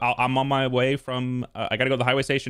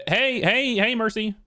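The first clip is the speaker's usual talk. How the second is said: nervous; 8 semitones higher, slower than usual. Real, not acted. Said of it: "you just sound like you're, like, got so much adrenaline".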